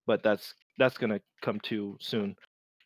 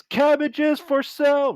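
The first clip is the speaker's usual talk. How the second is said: very high, loud, drawn-out market cry; hawker's yell; 8 dB louder than usual. Acted. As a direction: bellow it like a street hawker. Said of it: "this male human yells".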